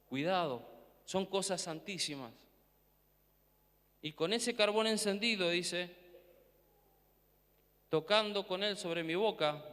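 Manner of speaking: normal talking voice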